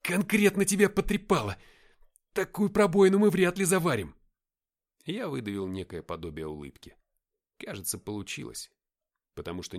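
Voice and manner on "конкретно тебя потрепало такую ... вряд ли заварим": high, loud exclamations; agitated chatter. A narrator's reading. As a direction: blurt it out loudly over a friend's wound. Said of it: "затараторил он".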